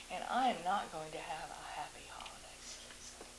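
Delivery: whispered aside; a quick hushed lament. Real, not acted. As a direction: drop to a bitter whisper. Aside about her neighbors.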